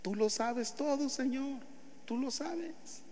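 high, soft, pleading voice; pleading Peter. Acted. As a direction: plead with breaking emotion.

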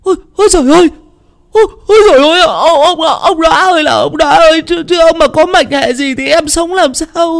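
very high, stammering panicked wail; panicked shrieking. Acted. Wife finding her husband's hand cold, panicked shrieking. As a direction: shriek for your husband in alarm. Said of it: "tiếng chu chéo của vợ".

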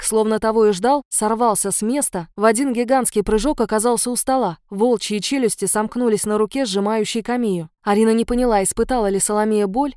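ordinary delivery

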